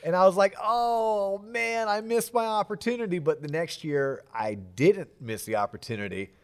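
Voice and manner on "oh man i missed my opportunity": high drawn-out lament; acted-out disappointed groan in words; mock dismay. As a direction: lament a missed chance in a raised pitch. Retelling his letdown reaction.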